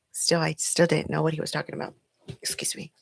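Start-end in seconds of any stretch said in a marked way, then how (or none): 2.4-2.9 a quick soft whispered excuse me. Real, not acted. Aside to viewers while tending her baby, whispered. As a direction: duck out of the take with a hushed apology.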